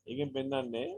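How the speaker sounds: ordinary delivery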